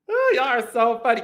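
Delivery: ordinary delivery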